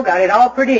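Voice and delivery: ordinary talk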